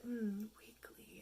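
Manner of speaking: breathy hushed whisper, slow; whispered reply. Retold or imitated. Quoting a masochist's dating-app reply, whispered slowly. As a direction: breathe it like a creepy secret. Masochist match answers her question.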